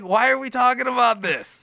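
high, loud flustered protest; mock exasperation. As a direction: act out a dad's bewildered protest. Retold flustered reaction to daughter.

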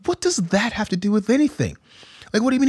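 high, mock-baffled question to the son; mock disbelief. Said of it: "I was like".

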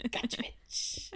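slow sly whisper; whispered gloat. Acted. Host quietly gloating as Tessa, whispered. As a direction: breathe it like a secret victory.

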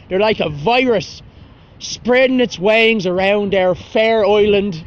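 hammed-up Irish accent, slow, in a put-on voice; bad mock Irish accent. Shown as a demonstration. Podcaster mocks the tourists to his listeners in a bad Irish accent. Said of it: "Awful fucking accent".

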